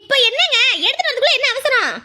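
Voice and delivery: high, harried complaint; exasperated retort. Acted. Wife rushed by husband's calls, exasperated. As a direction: push back at being rushed, pitch way up.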